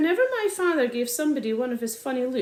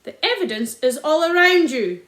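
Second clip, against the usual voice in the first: raised, louder angry voice; angry shout; 4 semitones higher, 8 dB louder than usual. A narrator's reading. Angry landowner shouting at the poachers. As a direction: shout it at the accusers. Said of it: "shouted Mister Hazel".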